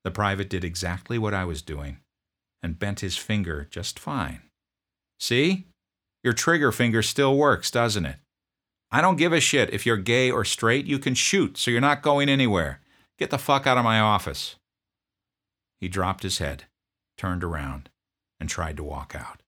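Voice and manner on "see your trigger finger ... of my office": loud, higher, curt demand; drill sergeant. Retold or imitated. Drill sergeant confronting a sniffling private, loud bark.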